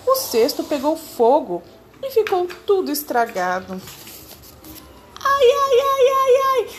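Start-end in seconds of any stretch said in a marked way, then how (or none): 5.2-6.7 very high, loud whining monkey cry; hammed-up squeaky monkey wail. A narrator's reading. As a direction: sob like a small monkey who lost everything. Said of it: "Bradou um macaco, gemendo e chorando".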